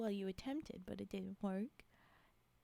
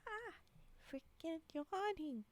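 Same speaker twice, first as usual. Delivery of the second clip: ordinary delivery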